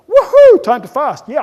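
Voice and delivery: high, loud whoop easing off; body celebrating. Acted. Body welcoming a fast, loud jubilant cheer. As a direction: whoop it like good news. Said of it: "your body will go".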